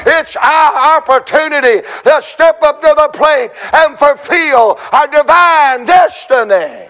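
rising, ringing preacher's call; preaching at full force. Acted. Pastor rallying the congregation, full-force preaching.

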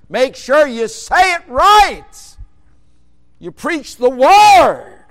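high, loud, slow, stretched out; thundering preaching. Acted. Preacher commanding young preachers to preach the word, thundering. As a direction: hammer every word home.

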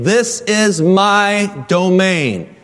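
stately mock lion voice; lion claiming territory. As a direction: draw it out like a lion marking its ground. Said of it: "and he is saying".

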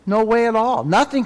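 emphatic preacher's denial; forceful preaching. Real, not acted. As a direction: drive the point home. Preacher insisting on grace alone.

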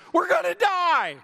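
high, loud panicked cry. Retold or imitated. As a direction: cry out like a fisherman about to drown. Disciples panicking in the boat.

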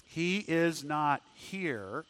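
"He is not here" drawn out very slowly and softly; slow solemn announcement. Acted. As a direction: let each word of "He is not here" land, very slow. Angels' words, slowed way down.

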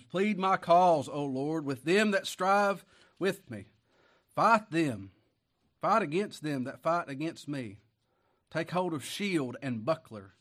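slow, loud pleading proclamation; fervent preaching. Acted. Preacher reading the psalm's plea for help, slow and loud.

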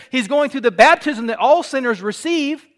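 ordinary talk, nothing marked

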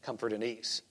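answering his own question, quiet and low; quiet punchline. Shown as a demonstration. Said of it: "You know what he's concerned about".

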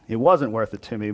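ordinary talk, nothing marked

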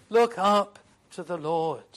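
ordinary talk, nothing marked

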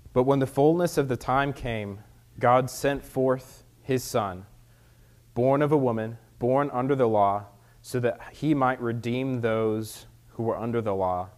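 loud, formal scripture reading. Acted. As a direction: read it out to the congregation. Reads scripture aloud.

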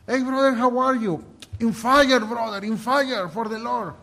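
loud, high, fired-up welcome; zealous church greeter. Retold or imitated. Churchgoers welcoming a newcomer, fervent shouted greeting. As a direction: greet a brother at full volume, high-pitched. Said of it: "You know what they, how they welcome you".

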